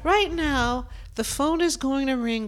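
"Right now" lifted high and bright; excited. Real, not acted. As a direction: jump on "Right now" with energy. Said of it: "Let's just go with this".

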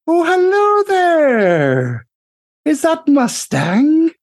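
high, slow, delighted squeal of a greeting; bright-eyed gnome engineer calling out in delight; gushing gnome mechanic. Acted. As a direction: sing it out like a cheery little tinkerer. GM voices the mechanic Greta.

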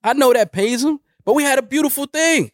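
high, wounded put-on voice; aggrieved mock rant. Complaining about his former partner, high mock-aggrieved rant. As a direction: lament a beautiful thing lost, pitched high.

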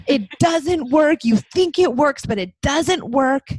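put-on loud, high shouting like a furious kid; imitating a daughter yelling at her mom; mock angry child yell. Daughter yelling at mom about parenting, imitated loud high angry shout. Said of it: "yelling at me and saying".

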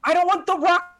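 high-pitched, worked-up outburst; exasperated protest. Real, not acted. Fan protesting The Rock in a Nintendo game, exasperated.

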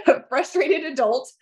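high, playful whine broken by laughter; half-laughing mock tantrum; laughing bratty plea. Real, not acted. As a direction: giggle through asking for a moment to sulk. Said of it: "to be a little brat".

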